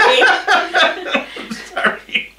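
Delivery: slow, giggling apology. Real, not acted. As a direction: apologize while still laughing. Husband apologizing while giggling.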